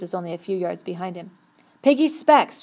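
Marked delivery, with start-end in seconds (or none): 1.8-2.6 high, loud urgent call; raised, urgent yell; shouted. A narrator's reading. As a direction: shout out the sudden realisation. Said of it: "shouted Ralph".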